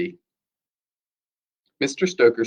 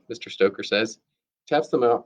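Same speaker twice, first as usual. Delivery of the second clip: usual speaking voice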